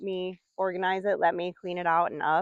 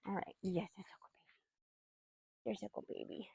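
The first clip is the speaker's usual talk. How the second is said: much softer cooing to a pet; 15 dB quieter than usual. Real, not acted. Owner soothes her pet with praise, soft cooing.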